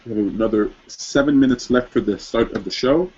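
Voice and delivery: ordinary talk, nothing marked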